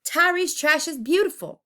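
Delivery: high, loud defiant cry; shouted. A narrator's reading. Neighbors shout to defend Tyree's trash, high and loud. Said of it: "others screamed".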